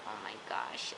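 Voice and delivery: breathless whisper of shock; whispered gasp. Retold or imitated. To herself, watching the show, a shocked whisper. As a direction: hush it like you can't believe it. Reacting to a TV scene.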